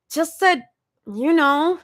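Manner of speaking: high, embarrassed mumble; mutter. A narrator's reading. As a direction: mumble it, flustered. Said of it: "Zoe mutters again".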